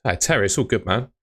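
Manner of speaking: ordinary delivery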